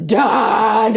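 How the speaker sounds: "done" drawn out long and heavy; emphatic capital-D DONE. Retold or imitated. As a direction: hit "done" like it's written with a capital D. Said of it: "Like, capital D".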